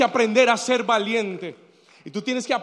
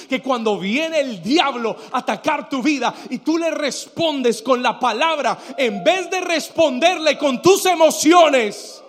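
rising preaching fervour; 4 semitones higher, 7 dB louder than usual. Real, not acted. Preacher on answering the devil with the word, rising fervour. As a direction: build to a loud, high finish.

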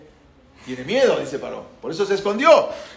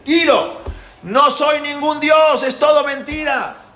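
high, loud, slow confession; shouting; 11 semitones higher, 6 dB louder, slower than usual. Acted. Pharaoh confesses he is no god, shouting. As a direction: bellow it out, stretching the words. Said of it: "se oyeron sus gritos".

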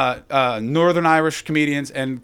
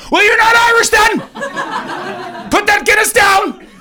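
very high-pitched hammed-up yell; over-the-top scolding shriek; mock outraged shout. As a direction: screech it in comic indignation. Acted outraged Irish rebuke to American.

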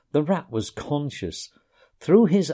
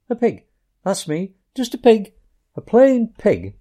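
self-reproach; 10 semitones higher, 6 dB louder than usual. A narrator's reading. Rat berates himself.

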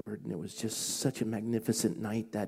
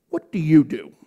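loud father's question, put on; 10 dB louder than usual. Father with Alzheimer's questioning his son, put-on voice. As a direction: imitate an old dad asking a stranger. Acts dad with Alzheimer's asking.

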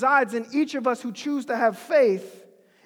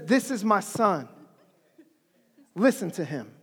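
voice of God; 6 semitones lower than usual. Retold or imitated. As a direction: lower the voice into a grave booming command. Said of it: "God's voice comes over the loudspeaker".

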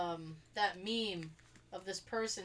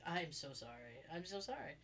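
low, soft miserable apology; mock misery; 6 semitones lower, 11 dB quieter than usual. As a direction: fake a sick-day apology, low and quiet. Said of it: "miserable voice message".

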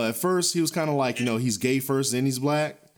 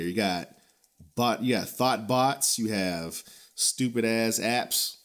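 ordinary talk

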